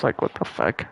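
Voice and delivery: a hushed disbelieving aside; whispered aside. Real, not acted. Gamer whispers to himself about the hand, hushed whisper. Muttering aside while playing.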